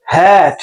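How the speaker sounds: HAD with the A stretched out; long drawn vowel. Shown as a demonstration. Teacher modelling for students, HAD drawn out long. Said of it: "লম্বা টান হবে".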